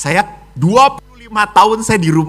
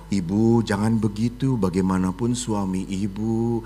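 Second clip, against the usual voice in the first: 1 gentle rebuke; 9 semitones lower, 9 dB quieter than usual. Retold or imitated. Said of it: "ibu yang baik".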